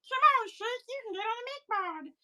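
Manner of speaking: very high put-on cartoon voice; cartoon character voice. Seller quoting Aqua Teen Hunger Force, high cartoon voice. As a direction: squeak it out like a cartoon sidekick. Cartoon quote on seeing the show.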